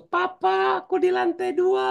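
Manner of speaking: put-on high slow child voice; child calling. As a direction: call out to papa like a lost kid. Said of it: "Yang satu".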